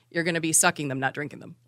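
ordinary talk, nothing marked

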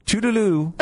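a hint of a British goodbye; British toodaloo. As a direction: chirp "Toodaloo" in a mild British accent. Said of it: "I thought I heard".

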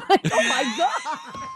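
slow, laughing 'oh my God' with a shouted edge; laughing outburst. Real, not acted. Cohost cracks up at her own voice, laughing shout. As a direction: laugh it out, drawing the words long. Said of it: "I don't know why I'm talking like this".

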